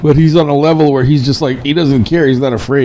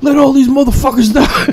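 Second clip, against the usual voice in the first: mock angry prophet; 11 semitones higher than usual. Mocking angry street prophets, hammed-up fury. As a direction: boom it out like a furious street prophet. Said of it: "The most angriest fucking prophets in the world".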